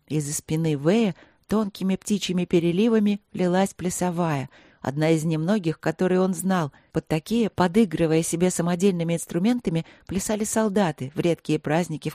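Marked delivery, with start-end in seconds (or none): none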